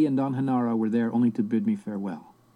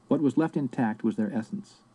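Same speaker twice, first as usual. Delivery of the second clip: plain reading voice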